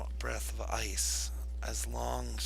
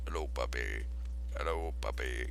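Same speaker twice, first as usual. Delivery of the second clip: deep puppy talk; 10 semitones lower than usual. Acted. Talking to the puppy, low.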